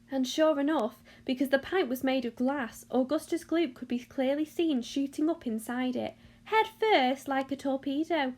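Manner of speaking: ordinary delivery, nothing marked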